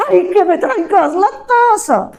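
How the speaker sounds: high, annoyed repeat; irritated asking again; exasperated repeat. Retold or imitated. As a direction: lose patience on the third try. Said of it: "¿Qué?".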